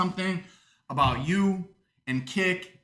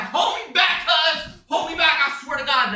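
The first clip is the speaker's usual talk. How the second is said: mock dwarf fight shout; 10 semitones higher than usual. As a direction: yell like a riled-up little brawler begging to be held back. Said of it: "And it's like".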